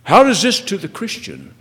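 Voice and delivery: loud, high preacher's questions; impassioned preaching. Real, not acted. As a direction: hammer each question at the congregation. Preacher presses the congregation.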